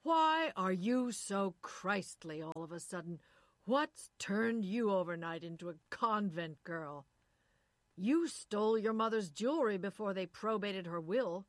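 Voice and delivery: loud accusing tirade; furious shout. Acted. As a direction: hurl every accusation at her. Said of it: "shouted at her from there".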